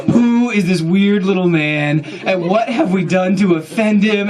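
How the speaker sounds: mock horror. Tall men voiced in mock horror.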